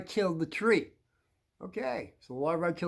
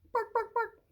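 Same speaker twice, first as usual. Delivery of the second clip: high yappy imitated barks; mock dog barks. Host joking about barking beetles, high mock barks. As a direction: yip bark bark like a little dog.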